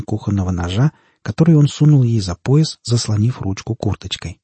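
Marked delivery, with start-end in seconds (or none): none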